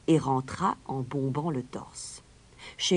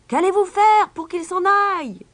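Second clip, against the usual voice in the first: much higher and louder desperate cry; crowd yelling; 11 dB louder than usual. Acted. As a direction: yell the question at the mayor. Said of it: "crièrent les gens de Hamelin". (cri aigu et fort de la foule)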